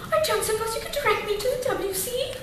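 quick, hammed-up British politeness; mock British toff asking the way; British accent. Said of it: "you could say".

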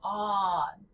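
"on" with the open American vowel, lips unrounded, no nasal; flat American "on" without rounding. Shown as a demonstration. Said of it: "American in English, it sounds".

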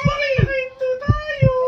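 high, slow crying plea to stop; scared begging to stop the ride; frightened plea. Real, not acted. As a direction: beg them to stop it, near tears.

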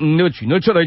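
plain reading voice

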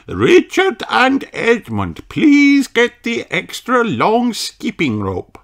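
high teacher voice giving an instruction; Madame Gazelle asking. A narrator's reading. Teacher to Richard and Edmund, high polite request. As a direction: ask the boys politely, high. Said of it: "she says".

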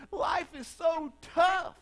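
put-on high, slow, loud wailing child; crying little girl. Pastor voicing a crying three-year-old girl, high, slow wail. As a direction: wail it like a sobbing toddler. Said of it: "She says".

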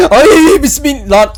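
high, loud yelp into a quick prayer; startled yelp. Real, not acted. Gamer startled by a mask, high yelp of fright. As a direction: jump at the sudden mask. Another mask jump scare.

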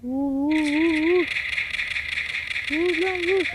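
egging on a teammate mid-fight, pitch climbing; excited urging. Real, not acted. Shooting in a tense firefight.

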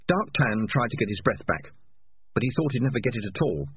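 plain reading voice

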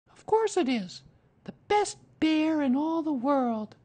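put-on high, loud boyish enthusiasm; excited. A narrator's reading. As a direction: praise a best friend in a high child's voice. Boy excitedly confirms the gift.

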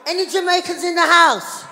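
high, loud shout to the crowd; calling out. Real, not acted. Comedian asking the audience who is Jamaican, calling out. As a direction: hail the whole room.

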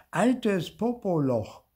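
high, drawn-out cheeky insult; a brazen kid's name-calling; cheeky taunt. A narrator's reading. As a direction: toss it out slowly and boldly. Child cheekily insulting the caretaker.

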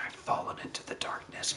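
very soft, voiceless mock-dramatic whisper; playful hushed whisper. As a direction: whisper it as if lost in darkness. Acts out falling into darkness.

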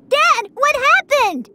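high, drawn-out worried cry; alarmed child. Acted. Child to changed dad, alarmed.

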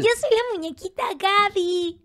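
aguda, lenta y cantarina; voz de muñequita. Shown as a demonstration. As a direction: presentadora infantil empalagosa. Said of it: "Así hablaba". (voz aguda y cantarina de animadora infantil)

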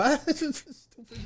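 high, slow, soft mimicry; mocking imitation. Father imitating his sons laughing at him, mocking. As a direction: mimic sniggering kids in a high voice.

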